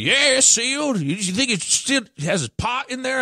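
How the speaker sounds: put-on high, strained old-guy caricature; hammed-up high-pitched older caller; clueless older dad. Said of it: "Did he have that awful accent too?".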